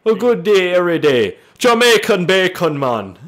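loud, high mock Jamaican accent. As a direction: belt it out as an exaggerated Jamaican impression. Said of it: "Are you Jamaican?".

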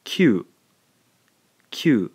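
"kyu" given as a single clear demo syllable; blended kyu. Shown as a demonstration. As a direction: blend "kyu" into one crisp beat. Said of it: "When we blend these two syllables together".

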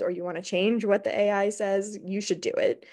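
ordinary talk

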